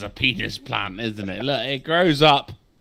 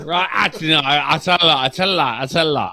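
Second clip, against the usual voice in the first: normal talking voice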